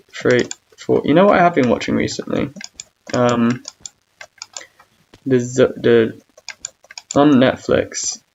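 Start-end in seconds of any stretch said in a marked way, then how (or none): none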